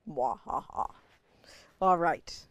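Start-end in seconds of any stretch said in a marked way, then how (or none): 0.1-0.9 breathy evil mwahaha cackle; mad scientist laugh. Pretend. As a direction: cackle like a villain over a joke. After spooky Halloween slogan.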